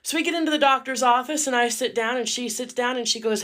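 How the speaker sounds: ordinary talk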